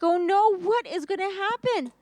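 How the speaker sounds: high, anxious wail; distraught. Acted. Brother fears being called a thief, distraught and high. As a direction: fret aloud in a high voice. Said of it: "He was so distraught".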